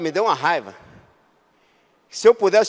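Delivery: usual speaking voice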